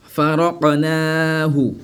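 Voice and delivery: "Faraqnahu" slow, echoed q, long naa; qalqala. Shown as a demonstration. Teacher demonstrates avec qalqala, slow recitation. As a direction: chant "Faraqnahu", bouncing fa-ra-qa-naahu. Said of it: "avec qalqala".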